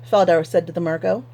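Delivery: ordinary delivery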